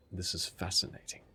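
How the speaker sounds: soft, confidential aside as Maury; hushed in-character murmur; whispering back. Pretend. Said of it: "Maury whispers back".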